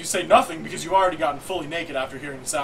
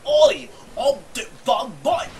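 high, loud bad Cockney; 6 semitones higher, 7 dB louder, slower than usual. Mocking the worst Cockney accent for a friend, hammed-up Cockney. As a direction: shout it in a terrible Cockney accent. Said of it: "he has the worst Cockney accent".

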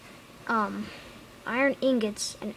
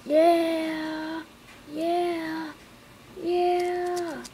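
higher, louder triumphant yeahs; cheering; 5 semitones higher, 3 dB louder, much slower than usual. Real, not acted. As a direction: celebrate the win. Celebrating a win.

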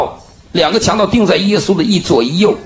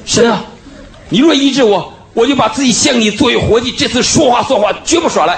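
fervent cry to God; 9 semitones higher than usual. Real, not acted. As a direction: throw the whole heart into a promise. His desperate vow to God.

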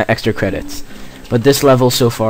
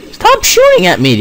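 much higher, loud protest; high frustrated yell; 4 dB louder than usual. Real, not acted. Gamer under fire yells at the enemy. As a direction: squeal at the shooter.

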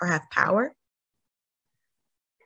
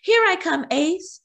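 eager call; 11 semitones higher than usual. A narrator's reading. Chase sets off to rescue.

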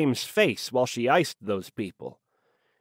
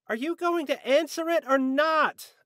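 annoyed yell. A narrator's reading. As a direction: pitch it high, calling irritably from another room. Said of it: "an annoyed Elise yelled from the other room".

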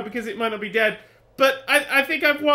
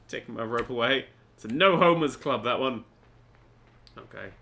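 ordinary talk

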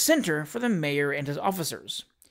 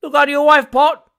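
much higher, much louder bellow; bellowed taunt; 8 semitones higher, 11 dB louder than usual. A narrator's reading. Fifth heckler bellowing at Pott, booming jeer. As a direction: bellow it over the whole crowd. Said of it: "bellowed a fifth".

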